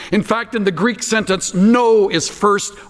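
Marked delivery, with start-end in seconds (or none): 1.5-2.1 "no" punched louder; a stressed, loud "no"; emphatic no. Shown as a demonstration. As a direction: hit "no" hard. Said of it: "way out of place and emphatic".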